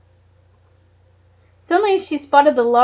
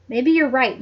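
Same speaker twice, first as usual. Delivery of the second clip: ordinary delivery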